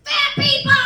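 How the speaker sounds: high, loud cheer of fat people; holler. Acted. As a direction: holler it out proudly. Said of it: "We'll holler".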